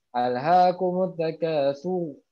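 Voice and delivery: takasur recited slowly, heavy r at the end; thick ro. Shown as a demonstration. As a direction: draw out takasur with a dark r. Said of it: "contoh misalkan domah".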